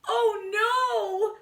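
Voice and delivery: high alarmed cry; cried. Acted. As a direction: cry out in panic. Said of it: "cried Scipito".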